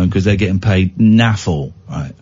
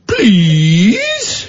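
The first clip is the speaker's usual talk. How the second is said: high, drawn-out mocking "Please?"; mock snippy please; 8 semitones higher than usual. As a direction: sarcastically demand manners with a rising "Please?". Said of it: "tell another grown adult to go".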